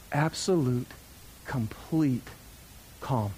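low, soft, one word at a time; hushed calm. Retold or imitated. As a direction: let each word settle into stillness, pauses between. Acts calm after chute opens.

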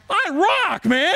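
high-pitched, hammed-up boast; self-satisfied victory cry; gloating triumph. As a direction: crow with mock pride. Boasting over trained puppy, acted.

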